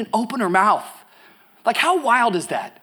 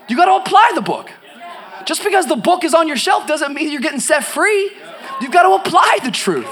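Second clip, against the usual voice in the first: higher, louder, shouting the point home; fiery preaching; 9 semitones higher, 4 dB louder than usual. Acted. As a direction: hammer it home to the crowd. Preaching at full force.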